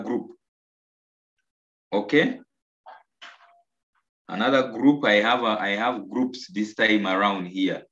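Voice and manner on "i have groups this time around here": ordinary talk, nothing marked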